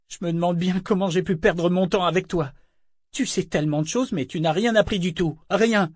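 high, scornful woman berating a fool; scolding Etta. Acted. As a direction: let her contempt spill over. (Etta qui injurie Yémin, voix haute et méprisante)

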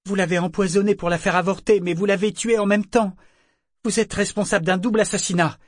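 Yémin denouncing the broker; outraged accusation. A narrator's reading. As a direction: fling the charge at him.

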